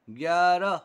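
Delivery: ग्यारह read out loud and clearly as a model word; ग्यारह said clearly for the class to repeat; demo reading. Shown as a demonstration. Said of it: "यहाँ पर सारे अल्फाज पढ़े जाएंगे". (ज़ोर से साफ़ पढ़कर दिखाना)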